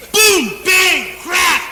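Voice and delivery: loud, high punched-out impact words; comic blow-by-blow crash; crash sound effects. Acted. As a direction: shout each word like a collision. Acting out the battleship crash.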